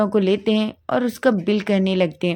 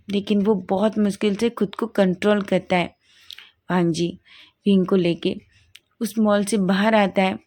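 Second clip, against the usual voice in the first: ordinary delivery, nothing marked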